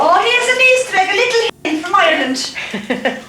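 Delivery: high, delighted show-and-tell; delighted presenting. Real, not acted. Showing a gift on a recording.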